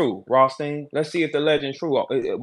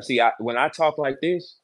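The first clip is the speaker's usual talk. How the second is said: usual speaking voice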